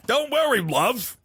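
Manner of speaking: a high, mock-sweet Lancashire voice; Lancashire accent. Host redoing the entity's line, high Lancashire accent. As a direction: coo it high like a Lancashire stranger. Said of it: "talk like bread would speak".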